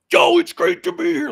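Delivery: sudden booming shock-rocker character voice. Retold or imitated. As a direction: flip the switch into the rock-star monster persona. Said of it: "went right into, like, odorous mode".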